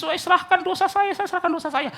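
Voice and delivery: high-pitched imitation of a penitent; mock fervent confession. Preacher imitating retreat-goers surrendering their sins, mock fervent confession. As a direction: lightly mimic an overwrought retreat-goer.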